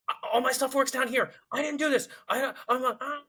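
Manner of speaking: high-pitched mock panic; protesting in a high, panicky put-on voice; freaking out. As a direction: imitate a flustered crewmate freaking out. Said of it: "He's freaking out".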